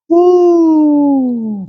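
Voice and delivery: high delighted woo; excited whoop. Real, not acted. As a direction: whoop at the sight of it. Sees the pie.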